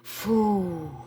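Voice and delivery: out-of-breath whoosh of relief; relieved puff. Real, not acted. Teacher after a fast tongue twister, relieved puff. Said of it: "Ну, не як я".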